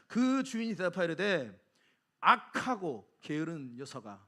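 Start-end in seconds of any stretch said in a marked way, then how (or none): 2.2-4.2 low, slow reprimand; stern rebuke. Acted. Master answering the lazy servant, slow stern rebuke. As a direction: rebuke him slowly, voice lowered. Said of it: "다른 의미로 혼을 냅니다".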